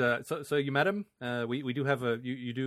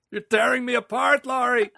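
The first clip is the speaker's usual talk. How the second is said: high, loud hammed anguish; quoting The Room; 13 semitones higher, 9 dB louder than usual. Host quoting The Room at Laurie, mock anguished wail. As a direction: wail it like a bad melodrama. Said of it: "we're obviously quoting from, The Room".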